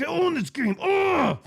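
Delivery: very high strained rant into a loud growl; hammed-up tantrum ending in a groan; lunatic outburst. Player acting out his meltdown in front of someone, lunatic outburst. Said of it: "I'm acting like that".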